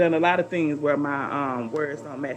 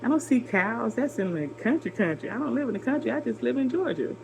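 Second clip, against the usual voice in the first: indignant retort; 6 semitones higher than usual. Retold or imitated. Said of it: "I'm thinking to myself".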